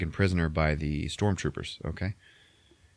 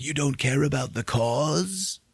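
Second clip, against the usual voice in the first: high, slow put-on voice; incredulous old rebel; 7 semitones higher, slower than usual. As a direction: draw out a mock-appalled question. Saw's acted incredulous question.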